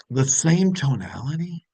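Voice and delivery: ordinary talk, nothing marked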